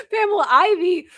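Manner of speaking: squealed high and delighted, half laughing; gleeful high-pitched squeal of the name; high excited repeat. Acted.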